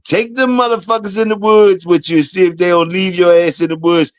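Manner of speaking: ordinary talk, nothing marked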